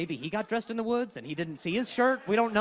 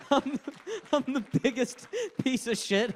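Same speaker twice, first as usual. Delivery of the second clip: normal talking voice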